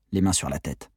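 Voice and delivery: ordinary delivery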